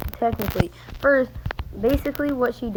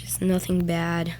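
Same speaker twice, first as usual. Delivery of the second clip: ordinary talk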